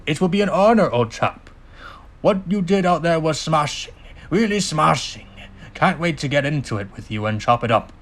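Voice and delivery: put-on clipped British accent. Cyril to Spyro on his victory, clipped British accent. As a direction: play a hearty British old chap, clipped. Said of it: "clipped British accent".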